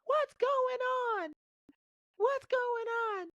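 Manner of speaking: a very high, put-on voice of a worried wife; imitating his wife. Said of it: "my wife comes down with Adeline being like".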